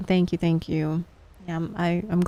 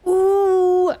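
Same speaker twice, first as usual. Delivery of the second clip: excited; 11 semitones higher, 6 dB louder than usual. Real, not acted. Reaction to game prompt.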